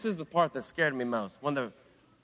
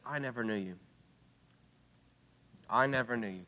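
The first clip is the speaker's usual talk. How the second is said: much softer, low and grave; solemn quiet rejection; 5 semitones lower, 7 dB quieter than usual. Acted. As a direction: let the words sink in, hushed. Jesus rejecting the foolish virgins.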